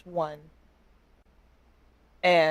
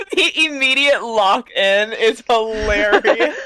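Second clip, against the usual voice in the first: laughing; 4 semitones higher, 9 dB louder than usual. Real, not acted. Game master cracking up.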